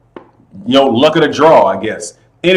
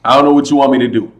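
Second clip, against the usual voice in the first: ordinary talk, nothing marked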